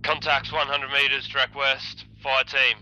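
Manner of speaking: shouted contact call. Real, not acted. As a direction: bark the enemy position to the team. Calling enemy contacts in firefight.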